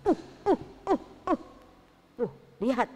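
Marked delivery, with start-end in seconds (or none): 0.0-1.4 angry grunts. Acted. Sambil menghentak-hentakkan kakinya.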